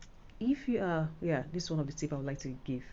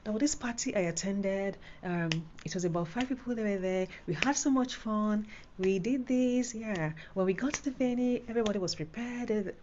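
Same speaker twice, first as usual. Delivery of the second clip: higher, lively and bright with fun; excited; 6 semitones higher than usual. Shown as a demonstration. Tutor showing how to tell a happy story, excited. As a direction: tell it like you loved every minute. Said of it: "the vibe needs to be there".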